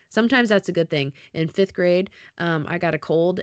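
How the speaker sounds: ordinary delivery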